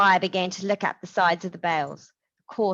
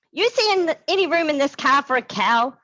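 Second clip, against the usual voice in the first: high, loud exasperated yell; shouted retort; 9 semitones higher, 7 dB louder than usual. A narrator's reading. Marisol shouting at James about the cow, exasperated. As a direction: shout him down. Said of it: "his sister shouted".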